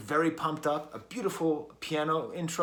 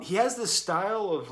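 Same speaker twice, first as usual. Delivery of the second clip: ordinary delivery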